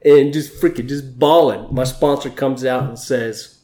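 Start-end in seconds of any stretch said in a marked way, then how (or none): 0.0-1.7 high and loud, agitated; upset, worked-up retelling. Real, not acted. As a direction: let it spill out, upset. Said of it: "I was so upset".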